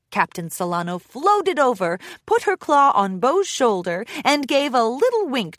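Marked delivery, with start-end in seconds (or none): none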